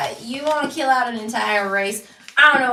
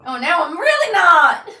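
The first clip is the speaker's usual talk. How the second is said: slow, louder mock despair; dramatic echo; 7 semitones higher, 9 dB louder, slower than usual. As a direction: ham up the doubt. Echoes the line louder, dramatically.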